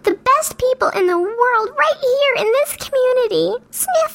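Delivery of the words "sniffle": spoken sniffle. Pretend. Mock tearful thanks to community.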